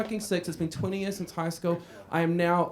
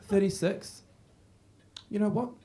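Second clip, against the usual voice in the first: ordinary talk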